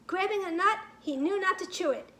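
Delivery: ordinary delivery, nothing marked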